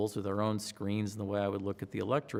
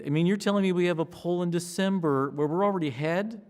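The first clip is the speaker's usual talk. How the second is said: high, loud, incredulous; can't believe the good news; stunned disbelief; 7 semitones higher, 7 dB louder than usual. Retold or imitated. Disbelief at poll lead.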